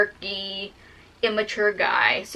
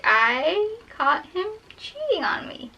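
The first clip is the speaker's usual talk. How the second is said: high, slow, tearful voice; tearful confession; 7 semitones higher, 3 dB quieter, slower than usual. Retold or imitated. Daughter telling mom about being cheated on, tearful. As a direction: drag it out, pitch climbing, close to tears.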